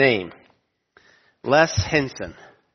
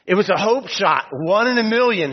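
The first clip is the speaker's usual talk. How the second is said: excited quote; 6 semitones higher than usual. Retold or imitated. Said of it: "Henson said".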